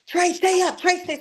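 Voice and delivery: high, urgent imitation of a pleading father; retold urgent plea. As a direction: urge him to stay awake, pitched high. Father urging injured son awake.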